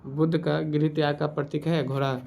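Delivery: ordinary talk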